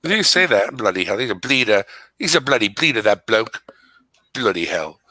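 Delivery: playful put-on British accent with slang; phony British accent. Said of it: "I'm gonna try to put on a British accent".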